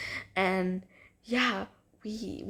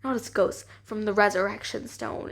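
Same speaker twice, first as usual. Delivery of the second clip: ordinary talk, nothing marked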